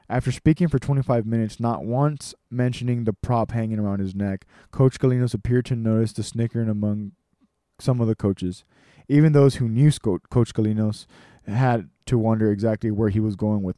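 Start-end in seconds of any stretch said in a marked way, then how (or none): none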